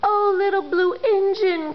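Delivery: high, begging toy voices; pleading toys. Acted. Toys begging the little blue engine, pleading. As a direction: beg the little engine for help. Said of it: "cried the dolls and toys".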